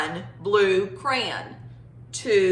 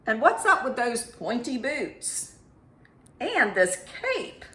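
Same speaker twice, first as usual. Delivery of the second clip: curious number Two; 4 semitones higher than usual. Acted. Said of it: "Two says".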